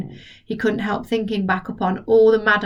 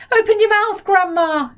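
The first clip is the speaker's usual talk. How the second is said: put-on much higher, louder urgent boy; cried; 11 semitones higher, 4 dB louder than usual. George cries out with the water jug, high and loud. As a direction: urge her loudly. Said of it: "he cried".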